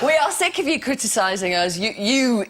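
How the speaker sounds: hammy French president, ranting; Sarkozy French accent. Acted. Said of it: "It's great, though. Just keep going".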